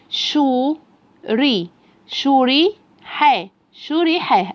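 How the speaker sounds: slow sounding-out, building up the word; syllable-by-syllable reading. Shown as a demonstration. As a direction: draw out each syllable, then join them. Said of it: "وأخليه يخطف الكلمة خطف كده عشان يقراها بسرعة".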